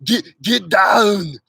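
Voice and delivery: "Get, get down" drawn out slowly in an Austrian accent; slow Arnold line. Shown as a demonstration. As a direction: stretch the words like Arnold. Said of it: "You have to start with".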